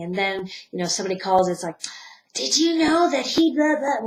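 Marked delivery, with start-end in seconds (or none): 2.3-4.1 higher, eager gossip voice; gossiping caller. Pretend. Somebody calls to gossip about him, eager and higher. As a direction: lean in with juicy news.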